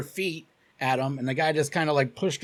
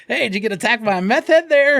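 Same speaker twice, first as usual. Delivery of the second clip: higher, louder call from a window; 7 semitones higher, 5 dB louder than usual. Retold or imitated. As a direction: shout a question over to passers-by. Calling out of the car window.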